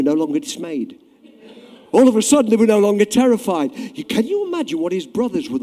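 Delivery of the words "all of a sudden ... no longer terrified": loud, high, triumphant build; rising triumph. Acted. Preacher telling how Israel rejoiced, loud and high. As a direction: lift the crowd with it.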